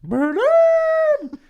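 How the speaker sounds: very high, loud creature shriek; Grimace voice. Acted. Grimace mocking the dead phone with a very high shriek. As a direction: squeal it out way up top. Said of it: "And he goes".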